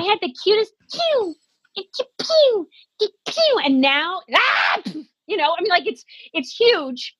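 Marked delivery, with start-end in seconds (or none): 0.0-4.2 tiny sneeze imitation. Imitating her childhood sneezes.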